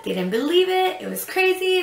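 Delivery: high-pitched amazed retelling; excited. Real, not acted. Vlogger recalls her parents learning of the baby, excited. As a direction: lift the voice with excitement.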